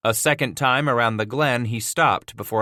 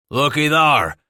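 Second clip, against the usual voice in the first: snorting trapper; 4 semitones higher, 5 dB louder than usual. Acted. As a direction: scoff it loudly. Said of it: "the first man snorted".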